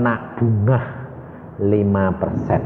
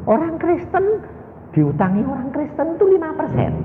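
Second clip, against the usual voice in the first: much higher, incredulous question; shocked disbelief. Retold or imitated. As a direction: buyer aghast at the five percent interest, voice shooting up.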